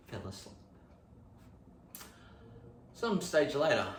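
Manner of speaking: ordinary talk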